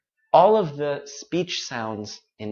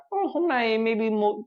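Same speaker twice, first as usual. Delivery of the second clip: higher, lightly delighted "oh, easier today"; pleased surprise; 8 semitones higher than usual. Retold or imitated. Teacher voicing a learner pleasantly surprised by progress. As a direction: pretend to notice progress with a pleased lift. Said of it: "các bạn sẽ thấy là".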